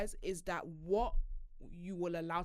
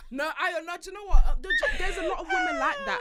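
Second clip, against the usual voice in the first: excited; 9 dB louder than usual. Real, not acted. Excited reassurance.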